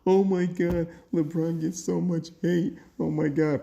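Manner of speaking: high, loud mocking whine of a complaining fan; mock whiny crybaby. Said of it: "this quote unquote".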